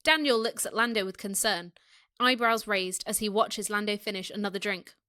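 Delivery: ordinary delivery, nothing marked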